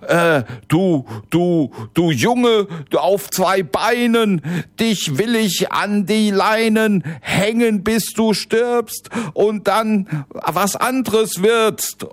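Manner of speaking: a flustered giant groping for a rhyme; fumbling giant. Acted. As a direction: giant botching his insult at the boy.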